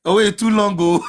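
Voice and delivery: ordinary talk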